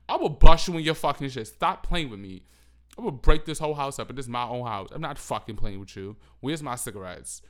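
explosive, violent threat shouting; furious yelling. Pretend. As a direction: yell it like an enraged addict. Acting out nicotine-withdrawal rage.

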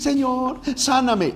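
high-pitched imitated plea; ruego imitado. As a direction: imitate someone begging for healing. Mimics someone begging for healing. (súplica aguda imitada)

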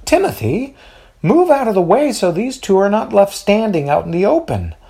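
high, urgent mother telling son off. A narrator's reading. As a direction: hurry him aside, mother's voice. Mother ordering son aside.

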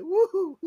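high, loud "woo"; delighted whoop. Retold or imitated. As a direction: whoop it up in admiration. Reacting to the man's hands.

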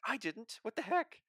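high, squeaky disbelief; teasing a friend about his late episode, high mock outrage; mock shocked squeak. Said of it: "you were just like".

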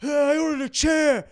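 high-pitched Italian-neighbor yelling; loud, drawn-out caricature of an Italian grumbler; old Italian guy. Acted. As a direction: holler it slowly like an old Italian man complaining. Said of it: "Between the Italian guy going".

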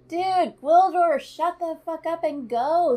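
loud, high exasperated yelling at the screen. Real, not acted. Viewer yelling at Gwildor on screen, loud and fed up. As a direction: yell it at him, fed up.

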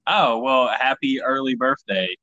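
ordinary delivery

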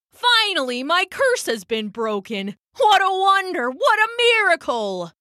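high, hammy wonder; overacted joy at a miracle; excited. Dives fakes joy at curse broken.

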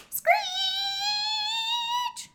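very high, loud held squeal; train-brake screech. Acted. Storyteller imitating the train stopping, piercing screech.

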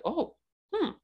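high, drawn-out mock puzzled hmm; a mom mulling over the news, a touch intrigued; curious hmm. Mother on the phone hearing a complaint, imitated curious hum. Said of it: "my mom thought it's quite curious".

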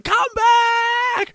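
very high, drawn-out screamed plea; imitated desperate shriek; mock scream. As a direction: scream it after someone running away, hammed up. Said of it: "she's screaming".

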